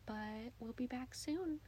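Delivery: soft slow ASMR sign-off; breathy whispered goodbye. Acted. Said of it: "like, an A S M R voice".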